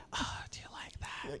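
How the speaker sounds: breathy whispered sultry question. Retold or imitated. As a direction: whisper it seductively. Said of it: "you could hear him".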